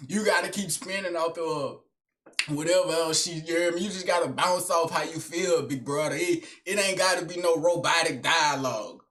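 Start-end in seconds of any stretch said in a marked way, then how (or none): none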